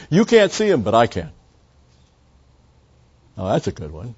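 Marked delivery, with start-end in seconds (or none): 0.1-1.3 mock preacher. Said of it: "And he'd say".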